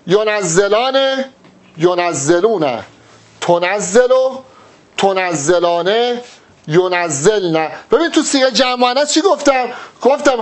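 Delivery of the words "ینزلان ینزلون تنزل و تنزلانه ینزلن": low, slow, drawn-out chant of each verb form; teacher chanting the past-tense table for students; slow paradigm recitation. Shown as a demonstration. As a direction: recite the conjugation one form at a time.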